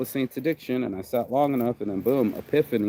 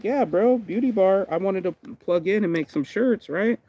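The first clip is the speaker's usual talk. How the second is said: excited retelling; 8 semitones higher than usual. Retold or imitated. Retelling his own excited reply.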